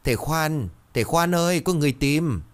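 high-pitched call from inside the house; urgent calling out. A narrator's reading. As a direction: call out to summon him, pitched well up. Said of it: "có tiếng gọi giật từ trong". (gọi giật giọng cao)

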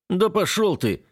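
snapped, pitched high; angry. Acted. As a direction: spit it out in anger. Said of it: "зло бросаю я".

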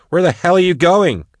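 high-pitched, forceful challenge; angry demand. A narrator's reading. Max challenging the departing hunter, angry demand. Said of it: "Max demanded".

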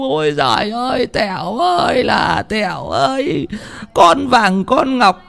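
high, drawn-out funeral wail; plaintive weeping lament. Acted. Father lamenting his dead daughter, plaintive weeping wail. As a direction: wail each endearment in a high, stretched cry. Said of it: "Tiếng khóc nghe cũng ai oán lắm".

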